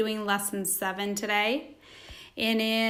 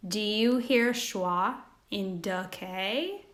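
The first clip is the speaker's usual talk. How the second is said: ordinary talk, nothing marked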